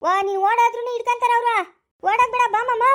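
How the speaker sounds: jeering shout. Acted. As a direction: shout after her as she runs. Taunting the fleeing woman.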